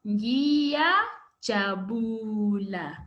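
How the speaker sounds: "ngiyajabula" drawn out very slowly, each syllable clear; slow drill word. Shown as a demonstration. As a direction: model "ngiyajabula" slowly for the class to repeat. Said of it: "Say with me".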